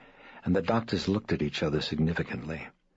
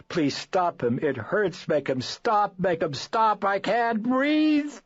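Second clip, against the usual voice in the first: much higher, rising desperate sobbing plea; weeping plea; 5 dB louder, slower than usual. Acted. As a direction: beg in mounting panic, out of breath. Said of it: "she wept".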